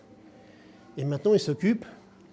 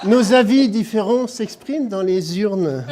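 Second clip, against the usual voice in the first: loud declaimed birthday verse, some lines slow; loud ceremonial poem reading; poem recital; 6 semitones higher, 7 dB louder, slower than usual. Acted. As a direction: read the tribute poem to the room, projecting. Reads birthday poem aloud.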